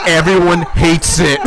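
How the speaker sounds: laughing retelling of a friend's quip. Retold or imitated. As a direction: laugh the words out. Quoting while laughing.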